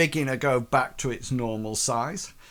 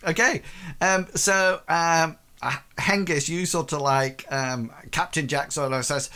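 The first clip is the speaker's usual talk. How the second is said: ordinary talk, nothing marked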